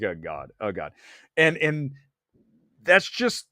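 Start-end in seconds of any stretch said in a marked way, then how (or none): 0.0-0.9 quiet dismay. Retold or imitated. Publicists' dismay.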